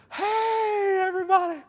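very high, stretched-out beaming greeting; hammed-up big-smile hello; Joel Osteen. Preacher imitating a grinning televangelist greeting everybody, high and cheery. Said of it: "I could do the Joel Osteen smile".

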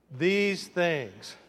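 THESE THINGS stretched, each word set apart; drawn-out prompt. Shown as a demonstration. Preacher leading the congregation to repeat THESE THINGS, drawn out. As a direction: say THESE THINGS slowly for all to join in. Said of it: "say it with me".

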